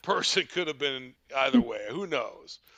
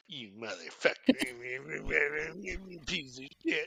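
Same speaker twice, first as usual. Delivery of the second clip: muttering; 6 dB quieter than usual. Acted. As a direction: grumble curses under your breath, imitating. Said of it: "see her just muttering".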